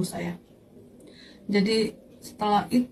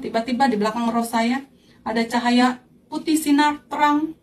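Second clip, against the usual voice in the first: ordinary talk, nothing marked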